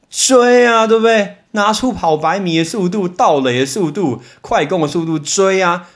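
fired-up rallying call; excited urging. Acted. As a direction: spur them on to give chase, the first word ringing out. Urging an imagined chase.

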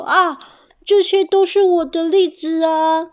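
high, loud protest; indignant outcry. Acted. Bear seeing hedgehog with his chestnuts, indignant. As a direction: cry out to claim what is yours.

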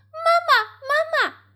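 very high, loud tadpole shouts; calling out. A narrator's reading. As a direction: call after mum in a piping shout. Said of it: "追上去喊". (小蝌蚪高声喊妈妈)

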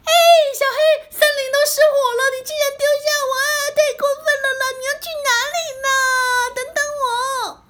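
high, fast tearful protest; angry crying. Acted. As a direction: wail at being left behind. Abandoned, upset.

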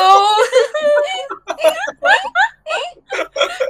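high, loud shrieking burst of laughter. Real, not acted. Friend cracks up at her own insult, shrieking laugh. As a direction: let the laugh explode into a squeal.

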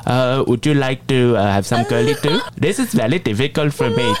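imitating an Indian speaker's English; Indian accent. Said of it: "半句印度话都不会讲". (印度口音英语)

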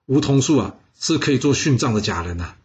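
usual speaking voice

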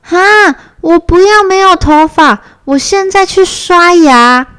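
惊讶的小孩大叫; surprised cry. A narrator's reading. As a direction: yelp in alarm like a small child. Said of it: "小熊惊讶地说".

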